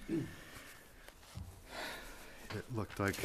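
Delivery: breathless, winded speech; panting between words; out of breath. Real, not acted. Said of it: "sorry for being out of breath".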